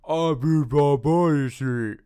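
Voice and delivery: loud, high mock-French gibberish; monster's garbled French. Quoting the monster's half-remembered line, high and loud. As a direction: babble it like a cartoon monster. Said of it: "as he's like".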